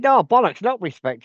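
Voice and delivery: high, loud angry snap; mock indignant host cutting her off; retold angry retort. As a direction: bite back at her hard. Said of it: "he said".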